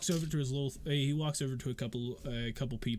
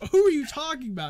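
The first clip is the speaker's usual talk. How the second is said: loud, high, demanding question; 13 semitones higher, 11 dB louder than usual. Acted. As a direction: call him out loudly. Joseph demands an answer.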